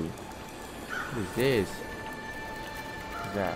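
slow hushed dread; slow whisper. Real, not acted. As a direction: draw the words out, barely voiced. Creepy discovery, hushed.

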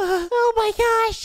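squeaky fluster. Acted. Nico flustered.

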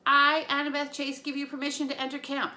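commanding announcement, rushed at the end; loud formal declaration. Acted. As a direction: declare it like a spell. Formal invocation at boundary.

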